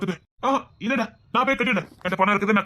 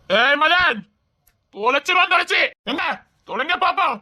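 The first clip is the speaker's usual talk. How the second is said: higher, daring shout; angry threat; 5 semitones higher than usual. Acted. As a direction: threaten them hotly. Heated threat.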